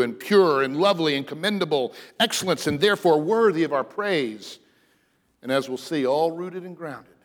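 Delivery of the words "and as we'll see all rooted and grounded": ordinary delivery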